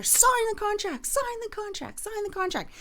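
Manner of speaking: high-pitched barked order, drill sergeant style; drill sergeant yelling. Retold or imitated. Husband yelling at his wife to sign, drill sergeant style. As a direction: bark it at her like a boot camp coach in her face. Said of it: "drill sergeant style".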